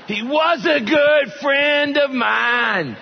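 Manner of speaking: high, laughing through the joke; cracking up at his own joke. Real, not acted. Pastor laughing at his own joke to the congregation. Joking he knew Jeremiah, laughing.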